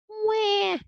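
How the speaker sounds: put-on very high baby wail; alarmed baby wail. A narrator's reading. Baby ghost scared by Frizzle's shout, high wailing cry. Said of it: "yelled Baby Ghost, alarmed at the noise".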